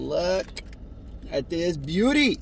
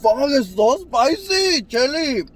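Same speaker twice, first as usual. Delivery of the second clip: loud pained outburst, higher; burning-mouth yell; 7 semitones higher, 6 dB louder than usual. Real, not acted. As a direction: yelp it from the spice. Reacting to very spicy bite.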